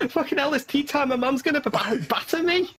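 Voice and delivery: put-on high, whiny Scouse kid; exaggerated high Scouse voice; Scouse accent. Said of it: "speaking of Scouse accents".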